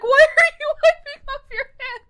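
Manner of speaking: high, loud offended protest through laughter; mock indignation. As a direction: squeal it as a hammed-up affront. Retold offended man, laughing.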